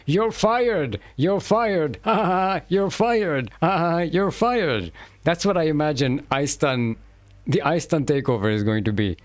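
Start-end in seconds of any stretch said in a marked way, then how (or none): 0.0-4.9 high, gleeful robot taunting with mock laughs; murderous robot. Robot exterminating humans, gleefully taunting them as it burns them. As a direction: cackle it out like a villain robot firing humans. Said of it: "just going".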